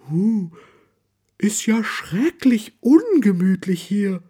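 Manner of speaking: high, slow astonished bear voice. A narrator's reading. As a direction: marvel at the mess, drawn out. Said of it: "staunt Frau Bär".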